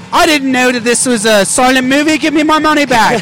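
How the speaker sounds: put-on huffy British moviegoer accent. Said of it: "people in Britain saying".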